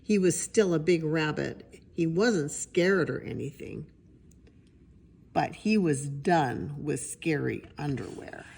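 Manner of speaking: plain reading voice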